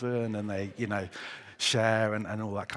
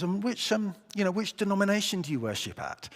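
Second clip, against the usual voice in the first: higher, eager voice for the keen questioner; 9 semitones higher, 3 dB louder than usual. Acted. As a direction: ask it like an excited new friend. Joke, first guy probing further.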